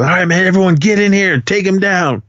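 mildly mad, high-pitched command; retold angry order. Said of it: "Porter's getting all mad".

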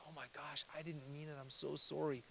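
very soft acted apology; hushed apology. Offender apologising to the person they hurt, acted in a hush. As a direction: drop right down as if apologising.

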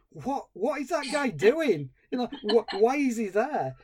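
high, swooping, incredulous questions; baffled viewer. Retold or imitated. Viewer distracted by the bad dancer, baffled and incredulous. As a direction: act out mock bewilderment, stretching the words. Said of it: "because you're thinking".

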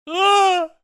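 high mock yelp of pain; tennis grunt. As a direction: imitate a sudden pained yelp. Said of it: "all you hear is a guy go".

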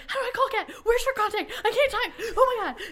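high, hammed-up frantic squeal; retold panic. Telling how she hunted for Kat's contact, mock panic. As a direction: act out an excited meltdown. Acts out her own panicked freak-out.

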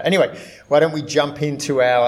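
usual speaking voice

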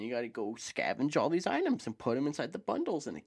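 swooping, exaggerated pitch on each demand; hammed-up sing-song fetch-quest voice; mock quest-giver. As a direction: play up a silly list of chores. Mocks the game's tedious fetch tasks.

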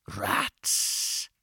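gleeful, hissing whisper; relishing whisper. A narrator's reading. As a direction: whisper it with creepy delight. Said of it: "Bleacher whispered with a certain relish".

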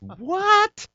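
his own stunned what on hearing the news. Retold or imitated. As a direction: blurt it out in total shock. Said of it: "I've just seen a ghost voice".

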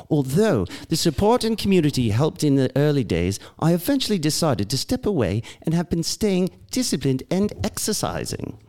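hammed-up British reading, sing-song swoops; British accent. Co-host reading Englishman's recovery email, hammed-up British accent. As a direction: read it like a theatrical Brit. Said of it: "read it in a British accent".

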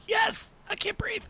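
very high, slow, strained. Pretend. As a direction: squeak it out, gasping. Acted choking patient reply.

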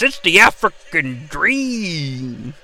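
low, slow, drawn-out ring-announcer intro. Acted. Introducing the African Dream to the crowd, slow announcer drawl. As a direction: stretch the name out like a ring announcer.

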